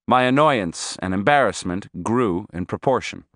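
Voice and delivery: ordinary delivery, nothing marked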